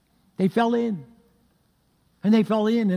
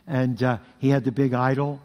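ordinary delivery

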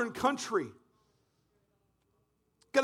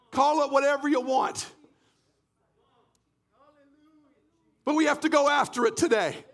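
fervent, raised preaching voice with shouted peaks; preaching at full force; 4 semitones higher than usual. Acted. Pastor urges the congregation to cry out, fervent preaching. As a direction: drive it home to the church.

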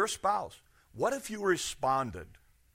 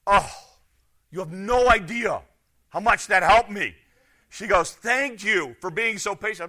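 bright, louder grateful gush; grateful cashier; 5 semitones higher, 6 dB louder than usual. Retold or imitated. Cashier thanking a patient customer, raised and grateful.

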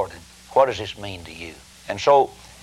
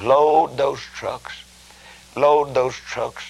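slow command; 4 semitones higher, much slower than usual. Acted. Slow emphatic command repeated.

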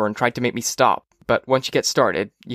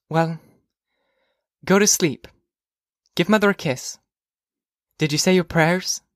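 lightly voiced mother, a bit higher; bedtime mother; 6 semitones higher than usual. Mother sending Phoebe to sleep, lightly voiced parental prompting. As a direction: settle a child for the night.

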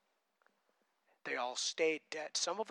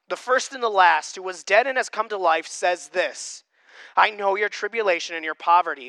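loud, deliberate Bible reading to the congregation; reading Revelation aloud to the church, in a proclaiming voice; scripture reading; 3 semitones higher, 10 dB louder than usual. Acted. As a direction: proclaim the passage from the pulpit. Reads scripture passage aloud.

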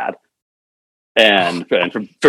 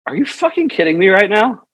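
incredulous outburst; 13 semitones higher than usual. Retold or imitated. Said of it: "we're looking at it and we're like".

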